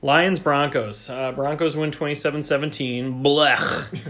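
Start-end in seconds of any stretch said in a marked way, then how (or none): 3.2-4.0 spitting disgusted blech; disgusted by taste. Real, not acted. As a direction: spit out a gross blech. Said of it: "You spit all over my table".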